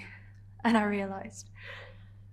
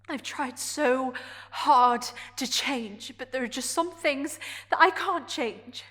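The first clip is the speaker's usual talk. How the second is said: raised voice, higher and insistent; impassioned protest; 6 dB louder than usual. Acted. Character protesting to Adrian that she can't change, impassioned. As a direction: plead your case with mounting frustration.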